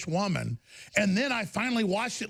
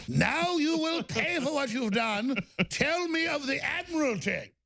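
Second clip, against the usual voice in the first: British interrogator; 6 semitones higher than usual. Retold or imitated. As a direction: demand it like a wartime inquisitor. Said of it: "I go".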